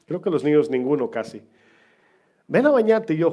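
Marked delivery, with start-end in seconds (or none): 2.5-3.2 grandma calling. Retold or imitated. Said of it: "mi abuela me mandaba a bañar".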